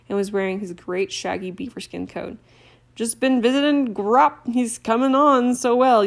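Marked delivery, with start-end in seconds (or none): 2.9-6.0 slow, hearty giant's voice, a touch louder; slow and homely like a big gamekeeper; big friendly giant. Acted. Hagrid to Harry, cheerful and slow.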